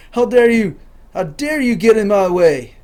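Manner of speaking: loud, high indignant protest; mock outrage. Angry driver confronting whoever got in the way, mock outrage. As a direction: play up the indignation, loudly.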